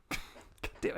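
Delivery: usual speaking voice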